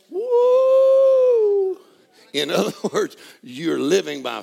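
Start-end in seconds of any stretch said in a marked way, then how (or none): 0.1-1.8 high, loud joyful whoop. Real, not acted. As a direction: let out a whoop of joy. Preacher's joyful cry.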